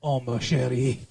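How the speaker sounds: cartoon French accent. Shown as a demonstration. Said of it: "I can also do French".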